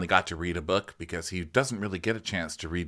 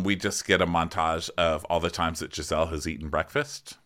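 ordinary talk, nothing marked

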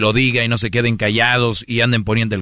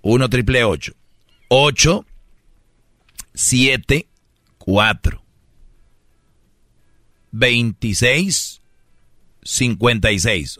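slow, with pauses between numbers; slow dictation; slower than usual. Shown as a demonstration. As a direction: spell out the number slowly. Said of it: "voy a decir el número despacito".

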